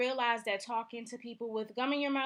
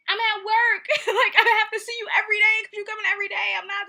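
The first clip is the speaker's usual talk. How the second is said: higher, louder, laughing through the words; 10 semitones higher, 11 dB louder than usual. Real, not acted. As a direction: laugh it out in disbelief. Laughing while ranting about coworker.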